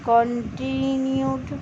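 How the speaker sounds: কন্টিনিউড said slowly, every syllable clear; careful pronunciation. Shown as a demonstration. Teacher showing students how to say continued. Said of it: "এরমভাবে উচ্চারণ".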